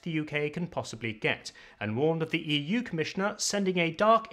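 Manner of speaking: upper-class English newsreader, resonance pulled back into a tight throat; upper-class English accent. Shown as a demonstration. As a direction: read the weather like a BBC presenter in a clenched upper-class English accent. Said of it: "you're taking all that resonance from up here and you're moving it right back here".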